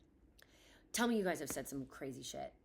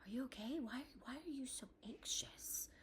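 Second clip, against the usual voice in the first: mock soothing whisper; 5 semitones higher, 12 dB quieter than usual. Mother imitating a nurse calming her, in a soft whisper. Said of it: "she comes in and she was like".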